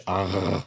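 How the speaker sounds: soft "r" made as a guttural throat sound; soft gimel, guttural. Shown as a demonstration. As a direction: rasp the "r" in the back of the throat. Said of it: "make that guttural sound".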